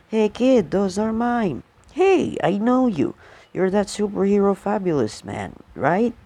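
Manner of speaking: put-on high, eager kid calling out; excited kid voice.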